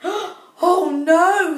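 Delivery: sudden alarm at the news; shocked little cry; Kitty dismayed. Acted. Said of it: "said Kitty".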